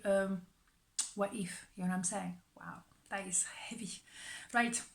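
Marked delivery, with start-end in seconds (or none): 2.6-3.9 hushed whisper of awe. Real, not acted. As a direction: breathe it out in a whisper. Reacting to a heavy card.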